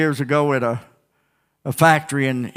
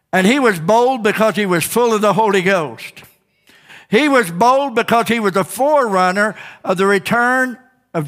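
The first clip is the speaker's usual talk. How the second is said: raised, driving preacher's cry; fiery preaching; 6 semitones higher than usual. Acted. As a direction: push every line out to the congregation. Preaching at full force.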